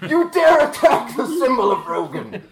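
high, loud indignant challenge; hammed-up outrage. Rogan's follower blocking with his shield, theatrically affronted. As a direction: proclaim it as a mock-offended zealot.

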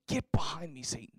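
hushed, breathy whisper with no voice; Jesus's rebuke retold in a stunned whisper. Shown as a demonstration. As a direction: whisper Get behind me like a secret rebuke. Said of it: "Jesus saying".

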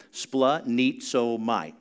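"Splenizomai" drawn out slowly, syllable by syllable. Shown as a demonstration. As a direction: spell out "Splenizomai" slowly so they can follow. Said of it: "Everybody say it with me".

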